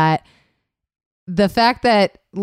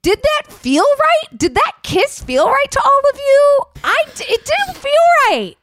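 high-pitched, appalled questioning; high incredulous protest; 14 semitones higher than usual. Real, not acted. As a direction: squeal the question in disbelief. Incredulous about the movie kiss.

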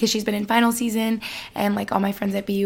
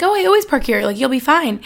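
breezy friend; 4 semitones higher, 6 dB louder than usual. Retold or imitated. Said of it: "Taylor was like".

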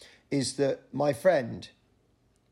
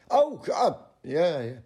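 fake recognition; 3 semitones higher, 3 dB louder, slower than usual. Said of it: "feigning recognition".

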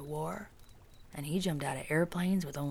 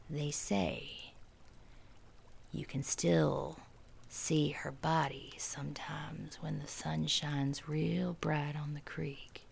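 slow spooky whisper; 5 dB quieter, slower than usual. A narrator's reading. Ghostly hushed narration.

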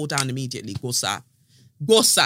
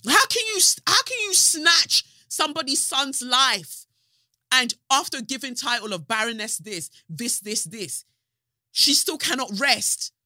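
much higher, louder indignant cry; outraged disbelief; 7 semitones higher, 4 dB louder than usual. Retold or imitated. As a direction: protest in shocked outrage. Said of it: "And I said".

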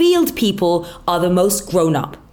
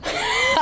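high squealing laugh; 5 dB quieter than usual. Real, not acted. Loud laugh burst.

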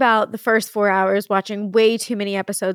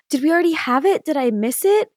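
put-on higher, louder kid's question; imitated worried child; 7 semitones higher, 5 dB louder than usual. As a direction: ask like an alarmed little kid. Retells Jack's worried question.

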